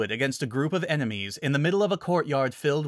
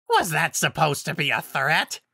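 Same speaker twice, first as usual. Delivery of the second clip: higher, scornful werewolf alpha; mocking monster voice, raised pitch; barked; 5 semitones higher than usual. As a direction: bark it out like the pack leader. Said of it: "the alpha barked".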